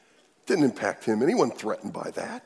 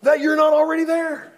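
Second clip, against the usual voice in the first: psalmist crying out, much higher and louder; impassioned plea; 8 dB louder than usual. Acted. Preacher voicing the psalmist, high and fervent. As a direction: call out the question to God. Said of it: "He says".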